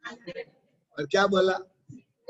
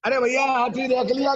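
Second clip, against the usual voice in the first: higher-pitched acted call; storyteller's raised-voice mahout question; elephant driver calling; 5 semitones higher, 4 dB louder than usual. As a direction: hail them as the mahout checking in. Mahout calls to blind men.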